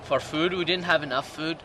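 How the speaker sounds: ordinary delivery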